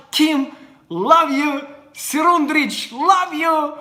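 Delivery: high, loud, slowed cheer; shout-out to fans. Real, not acted. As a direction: holler love to the fans.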